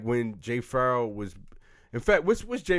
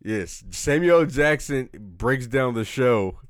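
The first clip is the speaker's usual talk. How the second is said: normal talking voice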